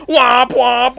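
high, loud honking womp; imitated car horn. Mimicking the neighbor's stuck car horn, loud and high.

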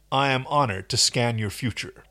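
ordinary talk, nothing marked

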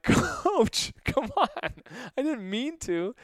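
pleading. Retold or imitated. Pleading with angry coach.

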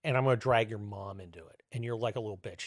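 ordinary delivery

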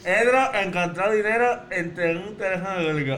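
slurred, lipless consonants; garbled mouth-game speech. Real, not acted. Mouth game, partner guesses distorted phrase.

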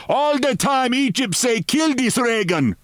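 put-on very high-pitched excited foreign accent; mock shout. A narrator's reading. Swarthy henchman clamouring for a killing, mock shouting. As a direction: cry out high without real volume. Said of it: "he shouted".

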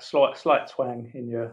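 ordinary delivery

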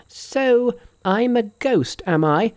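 very high, drawn-out challenge; indignant cry. A narrator's reading. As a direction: cry it high and slow, outraged. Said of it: "he cried".

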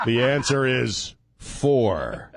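very slow, grand reveal; slow solemn proclamation. Pretend. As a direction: announce it like a divine revelation. Solemn revelation, laughing.

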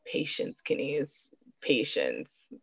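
hushed breathy self-calming; soft unvoiced reminder to herself; patience to herself. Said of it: "And I'm like".

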